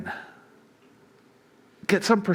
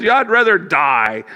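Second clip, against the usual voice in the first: higher, louder mock tragic sigh of a line; melodramatic despair; 4 semitones higher, 6 dB louder than usual. A hammed-up overreaction to a small trouble. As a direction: ham up the self-pity like it's the end of the world. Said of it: "we may not say it quite that dramatically".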